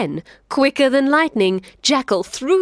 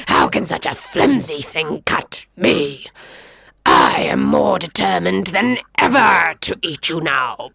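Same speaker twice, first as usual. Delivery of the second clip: low, determined predator voice; a hungry lion closing in; lion stalking the boy; menacing lion; 4 semitones lower than usual. Acted.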